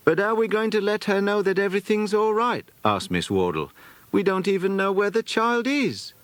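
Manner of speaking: lightly voiced woman, high and anxious; a fretting governess asking what's next; worried lady. A narrator's reading. Miss Wardle asking the family how to reach Elizabeth, worried.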